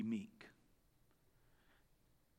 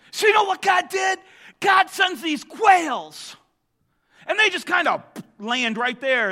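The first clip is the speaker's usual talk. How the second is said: preaching at full force; 9 semitones higher, 9 dB louder than usual. Real, not acted. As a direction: build the story up with fire. Preacher builds to a shout.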